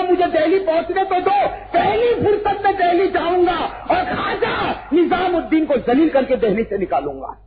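high, loud shouted threat; furious royal threat, shouted; king's angry threat. Acted. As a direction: shout it as the jealous king vowing revenge. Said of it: "बादशाह गयासुद्दीन ने कहा".

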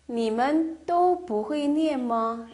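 each syllable of 会不会 stretched slowly; slow model reading. Shown as a demonstration. Teacher modelling the question for students, slow. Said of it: "model sentence read out".

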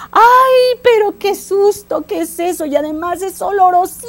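high, loud startled wail; terrified lion. Acted. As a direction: cry out in exaggerated fright, stretching the words. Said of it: "el león despertó asustadísimo y decía".

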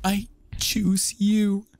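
high, slow, drawn-out vow; dubbing a teary wedding vow, high and slow; mock tearful vow. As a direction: stretch it out like a sappy bride. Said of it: "It's like".

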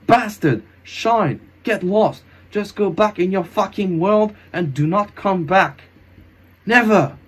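high, furious yelling; angry cursing. Acted. Hero banishing the ghost, furious. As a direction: hurl each curse at the ghost.

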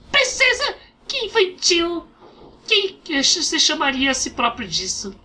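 high, scornful fairy voice; high mocking fairy. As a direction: scoff at her in a high fairy voice. Fairy mocks princess title.

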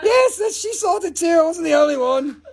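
high voice breaking with laughter; laughing. Real, not acted. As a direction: laugh through the words. Still laughing hard.

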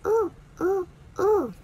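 ooh pushed forward, high and loud; ooh sound. Shown as a demonstration. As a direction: lips forward, voice out. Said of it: "you push your voice forward and out".

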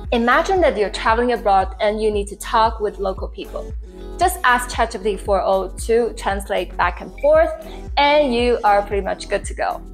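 ordinary talk

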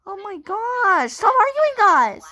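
very high play-acted whine at friends; exasperated plea. Doll begs friends to stop arguing, high exasperation. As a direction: plead high for the squabble to end.

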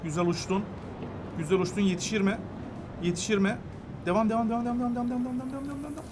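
soft, held-back encouragement; hushed murmur building into repeated urging; tense urging. Real, not acted. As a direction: coax it along quietly, fading away.

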